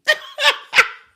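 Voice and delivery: high, loud laughing burst; hearty laugh. Real, not acted. Laughs after saying praise be.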